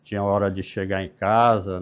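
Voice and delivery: ordinary delivery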